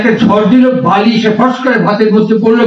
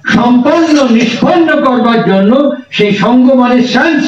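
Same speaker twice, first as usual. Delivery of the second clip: usual speaking voice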